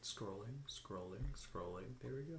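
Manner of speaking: muttering. Real, not acted. Muttering while scrolling pages.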